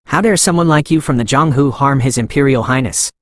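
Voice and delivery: ordinary delivery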